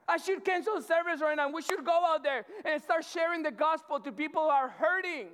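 loud, high, fervent preaching; preaching at full force. Acted. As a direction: drive the gospel home to the crowd. Preaching to the congregation.

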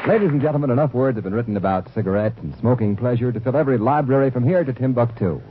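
normal talking voice

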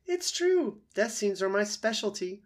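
high, theatrical opossum; boastful opossum. Acted. Opossum boasting of death scenes, high and theatrical. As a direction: brag with an actor's flourish.

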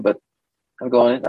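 ordinary talk

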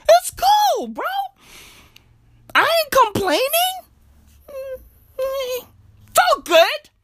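high squeaky protest that all is fine; mock acceptance. Comedian insisting he is fine about land ownership, high strained mock acceptance.

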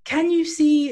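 put-on high, bright, slow kids show host; kid's voice. Shown as a demonstration. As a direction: greet children like a party host. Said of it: "I have to put on my kid's voice".

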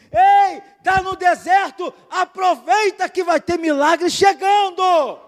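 loud, high prophetic declaration; prophet's voice. Shown as a demonstration. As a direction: proclaim it like a prophet to your brother. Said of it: "fala com voz de profeta". (voz de profeta, alta e solene)

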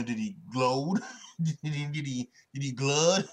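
laughing through a silly word attempt; amused fumbling. Real, not acted. Host hunting for the past tense of glide, amused fumbling.

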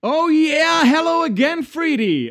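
excited high greeting. Acted. Acting a dubbing role.

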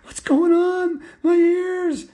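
schoolboy screaming in the bathroom. Acted. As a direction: scream in horror at his ears. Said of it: "he's screaming".

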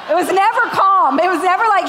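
laughing. Real, not acted. Laughing over cheesy shows.

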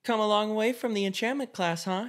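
lightly voiced smug classmate; Catalina's teasing. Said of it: "she says".

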